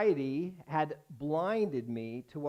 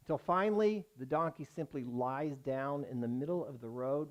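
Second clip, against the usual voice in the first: usual speaking voice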